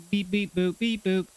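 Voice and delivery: high mock machine beeping; robot beeps. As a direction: beep like a scanner. Machine detecting "rack.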